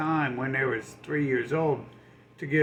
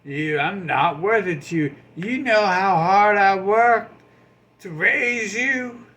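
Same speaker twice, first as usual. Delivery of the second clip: loud, higher wounded guilt trip; guilt-trip rant; 4 semitones higher, 6 dB louder than usual. Retold or imitated. Scorned liar guilt-tripping the poor giver, loud and higher. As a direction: shout the hurt accusation. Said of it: "they're like".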